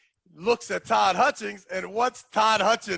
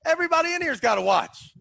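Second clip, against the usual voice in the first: high, quick defensive protest; retold protest; 10 semitones higher, faster than usual. Wearer retelling his defense to Kaplan, protesting. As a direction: argue back, pitched up and hurried.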